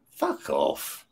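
the prisoner growls back at the cop, low and soft; low muttered retort. Retold or imitated. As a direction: grumble it low under the breath. Swearing back at the arresting cop.

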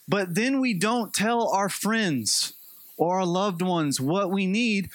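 ordinary delivery

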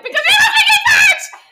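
high, loud mock yell with a laugh; yelling really loud. Teacher yelling at Joey, high loud laughing mock shout. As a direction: belt it at him, half laughing. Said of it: "I love when she yells really loud".

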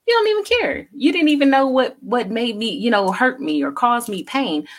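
high-pitched, indignant voicing of a hurt partner; hurt accusation. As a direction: imitate someone wounded and demanding, starting very high. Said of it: "feels like".

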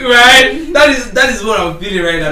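loud, excited shout with a cracked voice. Real, not acted. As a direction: belt it out over the mic. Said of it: "I'm able to shout this much".

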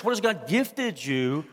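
usual speaking voice

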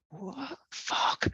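hushed gasp then a whispered curse; shocked whisper. Retold or imitated. Hiding beside the skaters, whispering in disbelief. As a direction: whisper it, stunned and trying not to be heard. Reacting to overheard plan.

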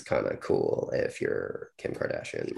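low, slow creaky fry; vocal fry. Shown as a demonstration. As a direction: let the words creak out in fry. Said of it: "vocal fry".

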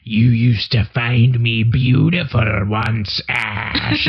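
slow, eerie imitation of a spurned witch; witch's line. Witch Sheila reproaching Ash, slow and eerie imitation. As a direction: drag it out like a scorned witch.

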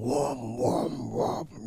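vocal "whoom" of a revving engine; imitated engine revving. Father showing off for the ladies, engine revving noise. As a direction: rev it up with the mouth. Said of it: "So he starts revving".

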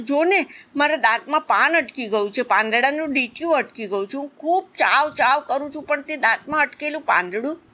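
high, whiny little croc begging mother; a small child begging his mother for help; pleading baby croc. A narrator's reading. Little croc whines to his mother about a stuck leaf, pleading high voice. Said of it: "મગરું કરગરતો બોલ્યો".

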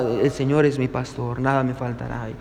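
low, soft recitation, imitating an embarrassed elder; ashamed old pastor. Elderly pastor reciting after the applauded actor, imitated as ashamed, quiet and low. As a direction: recite it humbly, low and small. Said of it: "se podía notar el temblor en sus palabras, tenía vergüenza".

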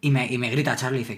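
ordinary talk

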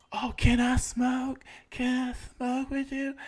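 high, slow pleading mock voice; high girly begging imitation, drawn out; mimicking her asking. Mimicking the woman asking for weed.

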